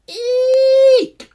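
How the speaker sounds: very high, very loud shrill owl screech; huge high owl shriek. Acted. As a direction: let out a piercing baby owl screech. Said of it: "He screeched".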